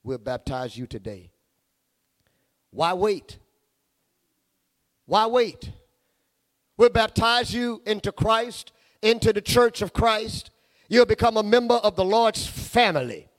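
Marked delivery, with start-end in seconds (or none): 2.7-13.3 higher, louder call to come forward; preaching invitation. Acted. Preacher inviting listeners to baptism, raised urgent call. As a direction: urge them to be baptized today.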